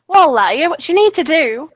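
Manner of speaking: loud, high mock-Scottish lilt; Scottish accent. Shown as a demonstration. Showing the accent she avoided, hammed-up Scottish. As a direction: put on a rough attempt at a Scottish accent. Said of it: "he's got a Scottish accent".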